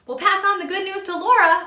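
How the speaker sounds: shouting after Percy as he runs off. A narrator's reading. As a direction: call it out after him. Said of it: "I shouted".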